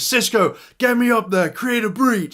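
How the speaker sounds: mock heroic shouted order; acted urgent call. Acts hero shouting an order.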